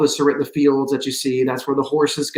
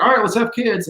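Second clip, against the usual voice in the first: mock breezy decision; 8 semitones higher than usual. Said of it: "be like".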